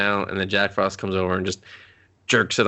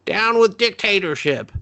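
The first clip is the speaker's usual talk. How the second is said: high, hammed-up battle cry; mock yell; 11 semitones higher, 3 dB louder than usual. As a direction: shout it like a rebel slogan.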